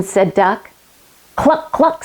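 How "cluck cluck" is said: high clucking cluck cluck; a hen's high clucks; chicken clucks. Acted. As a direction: cluck like a hen. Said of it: "said Chicken".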